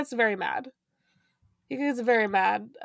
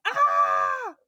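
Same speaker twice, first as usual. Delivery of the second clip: startled shout; 12 semitones higher than usual. Retold or imitated. Steven startled.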